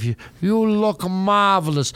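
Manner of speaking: drawn-out, slow "marvelous"; Billy Crystal's marvelous guy. Retold or imitated. Host imitating the SNL catchphrase, slow and drawn out. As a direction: stretch out "You look marvelous" like a showbiz host. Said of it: "Billy Crystal playing whatever character".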